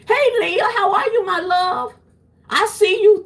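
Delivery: light up at a favourite viewer's comment; excited greeting. Real, not acted. Greeting viewer Leah in the chat, excited high hello.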